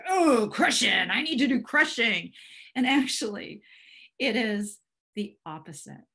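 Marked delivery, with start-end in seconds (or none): 0.1-2.3 loud, hammed-up mighty crusher; mock Thor crushing. Mocking people who take crush as Thor's, hammed-up loud voice. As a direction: overplay the urge to smash, loud. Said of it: "crush is like Thor crush".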